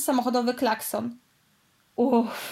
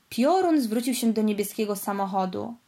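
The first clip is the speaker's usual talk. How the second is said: usual speaking voice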